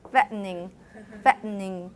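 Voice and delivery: fattening with the T caught in the throat; fattening: glottal catch, then N and ing; glottal stop. Shown as a demonstration. Teacher drills fattening for class, T as glottal stop. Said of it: "usually a glottal stop".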